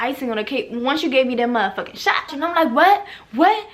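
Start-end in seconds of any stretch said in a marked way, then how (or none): 2.7-3.7 loud high demanding what; angry what. Retold or imitated. Shouting at the man across the room, angry. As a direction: confront him, sharp and loud.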